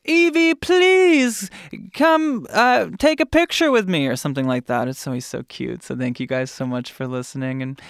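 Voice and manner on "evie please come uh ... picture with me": imitating fans. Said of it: "Or they say".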